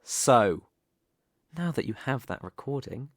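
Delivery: normal talking voice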